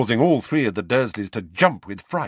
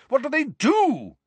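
very high furious question. A narrator's reading. Uncle demanding an explanation from nephew, furious. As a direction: demand it in exasperation. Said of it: "asked Uncle Vernon furiously".

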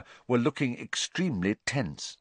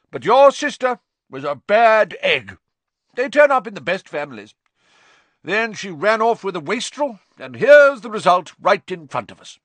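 put-on loud, high, bossy old aunt; Aunt Marge voice; 10 semitones higher, 8 dB louder than usual. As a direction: boom it out like a pompous aunt.

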